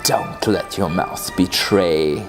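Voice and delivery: ordinary delivery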